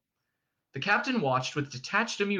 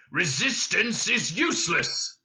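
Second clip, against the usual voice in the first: slow, raised monster voice; Vogon guard bellowing; 5 semitones higher, slower than usual. A narrator's reading. Guard to struggling prisoners, bellowing by rote. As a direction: bellow each word heavily. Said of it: "bellowed the guard".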